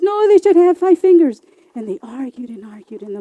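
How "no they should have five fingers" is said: high, loud retort; insistent lizard. Acted. Lizard arguing back at coyote, loud and high. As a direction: lizard refuses to give an inch.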